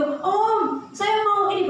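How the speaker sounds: put-on high, loud child's question; curious child. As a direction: kid calling out for the pastor. Child speaks to relative.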